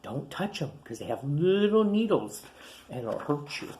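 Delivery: ordinary delivery, nothing marked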